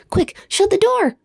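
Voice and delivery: high urgent call; cried. A narrator's reading. Little Bear urges Edith as Mister Bear comes. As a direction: hurry them before he sees. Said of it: "cried Little Bear".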